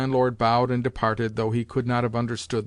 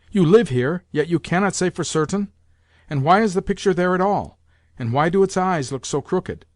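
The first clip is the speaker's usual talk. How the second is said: put-on a high, louder, demanding old lady; imperious grandmother; 6 semitones higher, 4 dB louder than usual. Grandmother grilling the landlord about the picture, imperiously. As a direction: fire questions at him like a bossy dowager.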